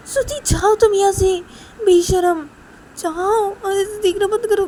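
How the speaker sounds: scolding Shruti for staring, high furious yell. Acted. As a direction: scream at her to stop staring. Siya yelling at shameless Shruti.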